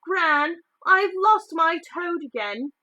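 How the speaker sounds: put-on round-faced boy wailing to his gran about his toad; boy complaining. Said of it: "was saying".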